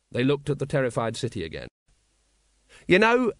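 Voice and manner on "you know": higher and louder, bursting in; eager little soldier butting in; eager Nobby voice. Acted. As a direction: pipe up eagerly as the little corporal.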